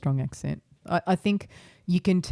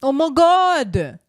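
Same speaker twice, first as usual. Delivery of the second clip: amazed; 7 semitones higher, 6 dB louder, much slower than usual. Real, not acted. Reacting to Kansas remark.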